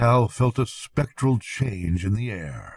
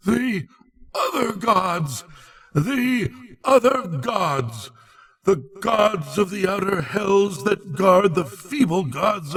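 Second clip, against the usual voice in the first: horrified cry; 9 semitones higher than usual. A narrator's reading. Falling sage crying out to his companion, high and horrified. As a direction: cry out in utter horror. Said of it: "A cry wherein reverberated the horror".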